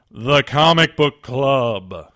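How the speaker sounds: loud, drawn-out title announcement; show title announcement. Acted. Host unveiling the show's segment, grandly announced. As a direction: boom out the show name, slowly.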